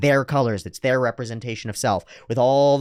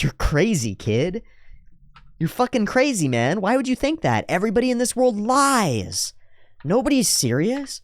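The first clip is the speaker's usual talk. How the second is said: voicing Lucy; 10 semitones higher than usual. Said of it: "And she says".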